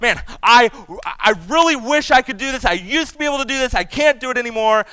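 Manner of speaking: lightly acted lament over lost ability; frustrated lament. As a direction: complain as if worn down by getting older. Voicing an aging person's frustrated lament.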